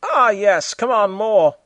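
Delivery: high, hammed-up moaning; mock moaning. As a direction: ham up the suggestive moans. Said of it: "panting were heard".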